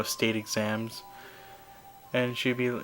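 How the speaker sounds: normal talking voice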